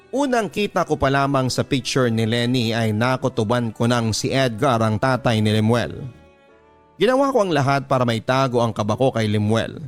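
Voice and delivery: ordinary talk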